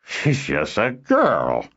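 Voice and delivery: put-on slow, gruff old man voice. A narrator's reading. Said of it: "a gruff old voice exclaimed".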